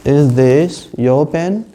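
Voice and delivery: slow drill of Is this your pen?, rising on pen; slow repeat. Shown as a demonstration. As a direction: model it slowly for repetition. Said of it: "शुद्ध उच्चारण अगर आपको बोलना है तो आपको repeat करना है". (धीमे दोहराने के लिए)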